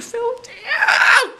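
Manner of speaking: very high laughing squeak; squeal and laugh. Real, not acted. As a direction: break into a laughing shriek. Squealing after pastor joke.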